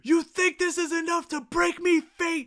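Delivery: high-pitched, loud defiant rant; screaming defiance. Retold or imitated. Fan acting out the hero defying fate, screaming. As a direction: shout it out as an imitation of an unbroken hero. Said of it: "Asta just starts screaming".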